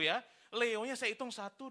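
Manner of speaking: usual speaking voice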